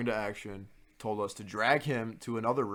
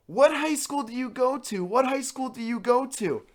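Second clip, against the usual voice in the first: high, loud repeated demand; imitating RA yelling at drunk student; mock RA shout; 8 dB louder than usual. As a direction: bark the question at the drunk.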